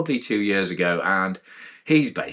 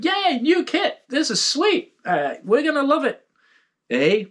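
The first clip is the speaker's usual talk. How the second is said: put-on high, giddy Canadian accent. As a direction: gush like a Canadian tester. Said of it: "I forgot my Canadian accent".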